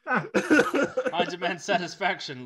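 booming, high, slow proclamation; loud theatrical outburst amid laughter; mock dramatic demand. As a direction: declare it like a hammed-up duel challenge. Mock character line during laughter.

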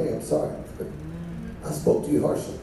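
quiet apology. Pretend. Voicing apology to Sister Lynn.

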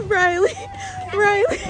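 high shouted call. Real, not acted. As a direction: call her name across the park. Calling to the child.

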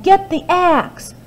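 high urgent call; yelled order. Acted. Jack shouting to his mother for the axe. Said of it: "he yelled".

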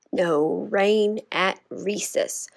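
slow, drawn-out no rain at recess; said slowly. Shown as a demonstration. Teacher showing the class a slow take of the chant. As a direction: stretch each word out slowly. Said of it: "Let's try it slowly".

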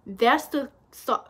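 ordinary delivery

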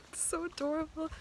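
normal talking voice

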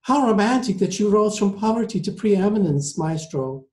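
high, gushing admiration; exclaiming. A narrator's reading. Passenger flatters the maestro at dinner, high exclamation. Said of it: "she exclaimed".

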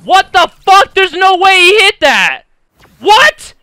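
very high, very loud outraged yell; furious shout. Real, not acted. Gamer raging at an unfair hit, furious shout. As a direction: scream in disbelief at the shot. Enemy lands unlikely shot.

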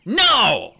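loud, high, explosive interruption; sharp, forceful outburst; spat out. A narrator's reading. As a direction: spit it out, cutting her off. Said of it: "Fireheart spat, cutting her off".

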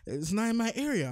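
ordinary talk

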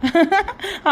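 short high giggle, caught out; a quick guilty laugh before admitting it; sheepish laugh. Acted. Daughter-in-law caught going out. (வெட்கச் சிரிப்பு)

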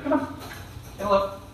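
high soft coaxing to the dog. Real, not acted. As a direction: lure the dog in with a high sweet voice.

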